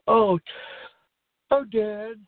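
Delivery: imitating a bully sweet-talking his dad; eager son. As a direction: gush it like a buddy sucking up. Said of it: "In his macho voice".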